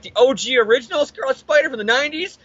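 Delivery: high, rising fanboy excitement; eager fan voice, climbing to the question; starstruck questioning. Acted. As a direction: ask it in awed mock disbelief. Starstruck fans question the hero.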